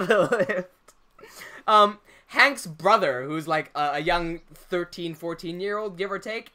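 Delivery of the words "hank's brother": ordinary delivery, nothing marked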